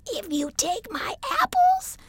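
tiny tree tempting with apples. A narrator's reading. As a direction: coax him with a tiny high voice. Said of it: "said the tiny apple tree".

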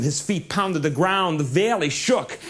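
plain reading voice

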